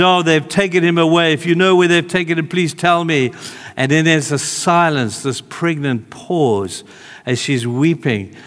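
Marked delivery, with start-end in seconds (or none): 0.0-3.3 high, urgent imitation of pleading; retold tearful plea. Mary asking the gardener for Jesus' body, pleading. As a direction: plead anxiously, without real tears. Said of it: "she's sitting outside and she's crying".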